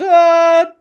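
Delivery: high, loud "ah"; falling yell. Acted. Po falling into the street, high yell. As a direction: cry out as if toppling backwards. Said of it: "he falls backwards".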